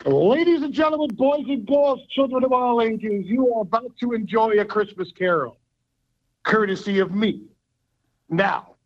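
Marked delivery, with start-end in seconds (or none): 0.0-7.4 grand entrance announcement. Pretend. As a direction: announce it like a circus ringmaster. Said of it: "here we go".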